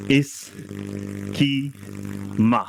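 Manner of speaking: इसकी held very long, then माँ after a pause; schema split as two words. Shown as a demonstration. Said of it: "जैसे अंग्रेजी में अगर इसका वैसे फोनेटिक्स नहीं बनाते".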